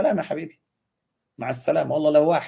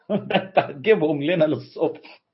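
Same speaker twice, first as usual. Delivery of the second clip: slow, higher-pitched words through laughter; laughing; 5 semitones higher, slower than usual. Real, not acted. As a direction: laugh your way slowly through the tease. Laughs while teasing the listeners. (بضحكة وهو بيتكلم على مهله)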